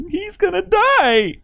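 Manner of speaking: high, slow mock sobbing wife; wife's wail. Quoting his crying wife, high and drawn out. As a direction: whine it like the upset wife.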